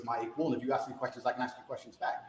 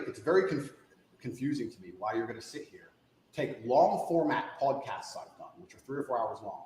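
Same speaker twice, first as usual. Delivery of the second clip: ordinary talk, nothing marked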